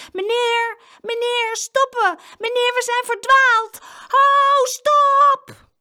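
high, loud shouts for help; desperate calling. A narrator's reading. Lost children hailing a passing farmer, desperate shouting. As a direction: shout to stop someone far away.

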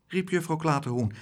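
usual speaking voice

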